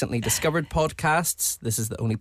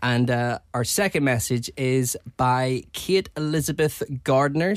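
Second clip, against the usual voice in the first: normal talking voice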